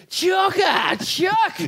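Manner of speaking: high excited calling out, half shouted; high-pitched fan calling his name. Retold or imitated. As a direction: call to him like a thrilled fan. Fans excitedly calling out to him.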